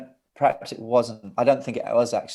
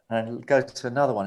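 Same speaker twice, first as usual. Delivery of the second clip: normal talking voice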